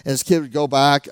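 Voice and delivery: ordinary talk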